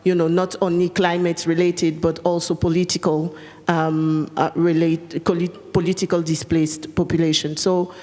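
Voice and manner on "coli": ordinary talk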